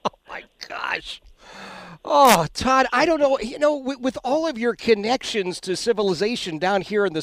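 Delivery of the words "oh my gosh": breathy whispered oh my gosh; 1 whispered gasp. Real, not acted. Host whispering in shock at the co-op story. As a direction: gasp it under your breath in disbelief.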